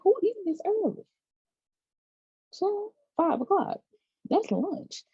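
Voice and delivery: high-pitched mock disbelief; incredulous. Reacting to dinner being served at five, incredulous. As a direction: squeak it out, aghast and playful.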